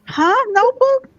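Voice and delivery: high, loud disbelieving squeal; shrieking what the, what; shocked surprise. Real, not acted. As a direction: react like you can't believe what's in the box. Unboxing an unexpected gift.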